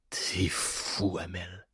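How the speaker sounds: voiceless whisper, a worn-out captive; hoarse whispered mutter. A narrator's reading. Exhausted prisoner whispers to his captor. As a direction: breathe it out, no voice left. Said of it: "la voix rocailleuse marmonna".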